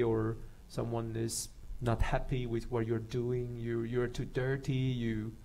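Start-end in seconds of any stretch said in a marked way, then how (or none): none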